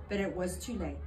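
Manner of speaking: plain reading voice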